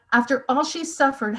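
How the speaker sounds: usual speaking voice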